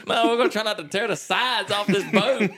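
high, indignant mock retort; worked-up bickering reply; retold comeback. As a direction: fire back in exaggerated protest. Retold bickering back at Bernie.

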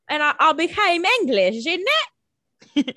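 loud, high-pitched caricature of English; mock English accent. Host mimics Catherine becoming English, hammed-up English accent. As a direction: ham up a broad English accent. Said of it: "I guess I'll do the accent".

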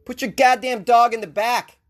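usual speaking voice

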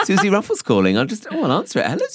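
high squeaky mock phone voice; high pretend phone call. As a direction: act out answering a call, high-pitched. Acting out accidental call.

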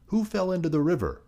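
high-pitched, startled mother; alarmed question. A narrator's reading. Mother asking Chang who fell in, alarmed.

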